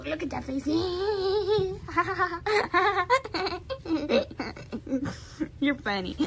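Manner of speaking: ordinary talk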